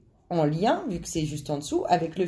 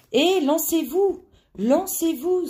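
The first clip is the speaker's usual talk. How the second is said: enthusiastic urging; 8 semitones higher, 5 dB louder, slower than usual. Real, not acted. Urging viewers to take the plunge.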